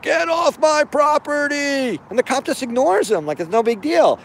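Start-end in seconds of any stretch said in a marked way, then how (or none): 0.0-2.0 a slow, high retold shout. Man shouting at the cop to leave his land. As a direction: bark it out like an angry property owner.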